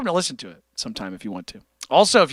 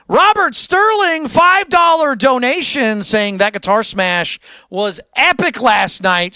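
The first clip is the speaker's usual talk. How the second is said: loud, high, drawn-out donation callout; hyped donation shout-out; 9 semitones higher, 5 dB louder, slower than usual. Real, not acted. Streamer hyping a donation from Robert Sterling, loud and excited. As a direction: read the donor's name like a big announcement.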